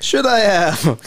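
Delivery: high, drawn-out mock of a timid reply; mocking echo. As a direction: parrot it back high and slow. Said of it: "lily-livered and subservient on one hand, and yet vaguely sarcastic and provocative".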